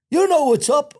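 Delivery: retold angry retort. Guard confronting a prisoner after a fight, angry retort. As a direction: throw it back at him, pitched high. Guard snaps back at him.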